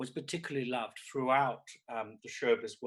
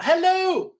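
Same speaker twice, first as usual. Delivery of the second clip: high, loud show greeting; big drawn-out hello, much higher and louder; calling out; 12 semitones higher, 11 dB louder, slower than usual. Acted. Host hails everyone at the party, calling out. Greeting the whole online audience.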